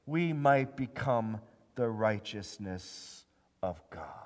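slow and deliberate, pauses between phrases; slow, measured reading. Acted. Pastor reading the verse for the church to memorize, slow. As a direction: lay each phrase down slowly.

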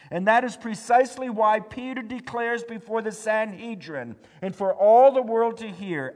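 loud, high, urgent preaching; full-force preaching. Acted. Pastor urging the church that we cannot save ourselves, preaching loud. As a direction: drive it home to the congregation.

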